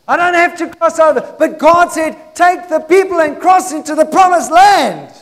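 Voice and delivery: urgent, rousing sermon voice; full-force preaching. Real, not acted. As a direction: preach it out to the whole church.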